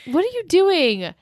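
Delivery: high, incredulous question; shocked demand. Retold or imitated. Lily confronting Rufus as he barges in, incredulous. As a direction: throw it up high in disbelief.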